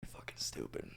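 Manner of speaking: barely audible retold aside; exasperated whisper. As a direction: whisper it under your breath. Muttered reaction to customer.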